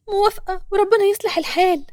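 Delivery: put-on high, lifted young woman's voice. A narrator's reading. Nour accepts the lady's offer, high voice. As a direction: raise the pitch well up to agree.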